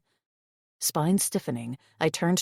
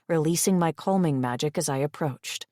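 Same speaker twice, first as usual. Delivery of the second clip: plain reading voice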